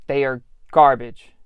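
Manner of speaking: loud, punched verdict; emphatic. Real, not acted. Store manager ranting about the Shreveport managers, loud and hard. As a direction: slam the word garbage down.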